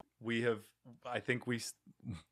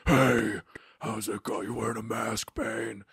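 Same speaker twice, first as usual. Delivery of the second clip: Batman impression. Batman mocking Bane for wearing a mask, Batman impression. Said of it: "that was my Batman impression".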